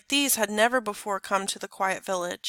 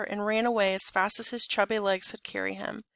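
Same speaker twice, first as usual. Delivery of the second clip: ordinary delivery, nothing marked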